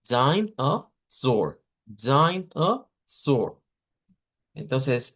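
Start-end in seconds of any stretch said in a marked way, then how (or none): 0.1-3.6 "dime a sword" said slowly, syllable by syllable; slow syllable demo of "dime a sword"; demo pronunciation. Shown as a demonstration. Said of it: "esta primera suena así".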